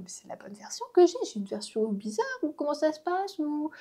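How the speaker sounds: acted-out baffled wondering; retold confusion. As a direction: replay your bewilderment, pitch climbing. Acts out her confusion while watching.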